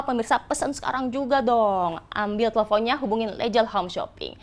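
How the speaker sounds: upbeat high hard-sell pitch; infomercial. Acted. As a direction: sell it to the viewers at home. Host's hard-sell pitch to viewers.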